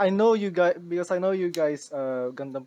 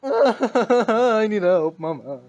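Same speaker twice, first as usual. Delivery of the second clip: laughing plea; 6 semitones higher, 11 dB louder, much slower than usual. Acted. Loud, slow, laughing plea.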